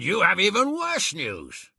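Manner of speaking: ordinary delivery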